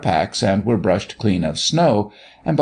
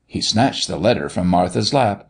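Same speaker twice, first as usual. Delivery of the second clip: plain reading voice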